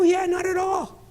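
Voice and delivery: slow, high, drawn-out retold reassurance; high-pitched assurance. Dad assures disbelieving daughter, high-pitched assurance. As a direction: stretch it out way up high.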